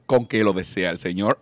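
booming polite waiter; two-metre angel barman asking for his order, deep. Acted. Angel waiter asks mixer.

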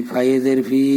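ordinary talk, nothing marked

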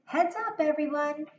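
high, loud warning call; yelled. Shown as a demonstration. Teacher repeating Anne's yell for the children, loud. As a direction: shout it across the room. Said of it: "she's actually yelling it".

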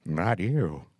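lightly voiced dry old comic; Groucho put-down. Groucho snubbing the woman who asked about dreams, dry deadpan. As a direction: drop it flat and dry. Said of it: "He looked at her and said".

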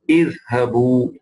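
Idhhabu drawn out slowly; slow model of Idhhabu; Arabic pronunciation. Shown as a demonstration. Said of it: "Antum is idhhabu".

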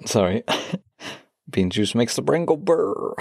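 ordinary talk

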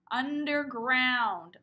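underground at half speed; slow stretched word. Shown as a demonstration. Teacher drawing out underground for preschoolers. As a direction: pull every syllable long. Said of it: "Uh, uh, underground".

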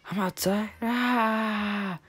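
shrill scream of falling; high falling scream. Pretend. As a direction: scream as you drop. Character falls, screams.

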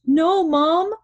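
high, loud cry to Mom. A narrator's reading. Scared Matt begs his mom, crying out high and loud. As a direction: cry out in protest, pitch soaring. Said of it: "Matt cries".

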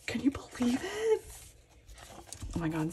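breathless whisper of disbelief; awed whisper. Real, not acted. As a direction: whisper it like you can't believe it. In awe of the signed items.

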